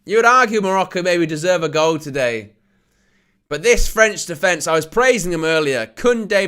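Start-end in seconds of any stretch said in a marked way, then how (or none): none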